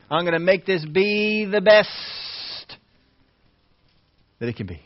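building preacher's fervor; rising zeal. Real, not acted. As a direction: push it up with conviction.

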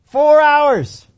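loud, high exasperated cry of the hours; exasperated groan. Retold or imitated. Disciple fed up with waiting, loud exasperated cry. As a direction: moan it out like you can't wait any longer.

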